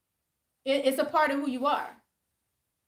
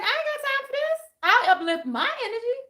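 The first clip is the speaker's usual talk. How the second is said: dismissive; 11 semitones higher than usual. Retold or imitated. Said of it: "And I was like".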